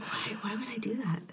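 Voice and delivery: a retold, half-joking whispered why; incredulous whisper. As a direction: whisper it like you can't believe the question. Retold reply to professor, incredulous.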